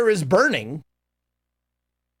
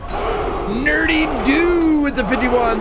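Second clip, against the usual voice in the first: announcer call; 8 semitones higher, much slower than usual. Acted. Thanks a donor, announcer call.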